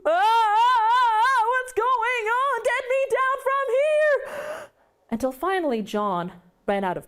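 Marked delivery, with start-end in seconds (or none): 0.0-4.7 high, loud, slow "ah ah ah" then a frantic plea; terrified cries. Acted. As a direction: yelp in fright while dangling in the air. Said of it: "And all Mister Prune could do".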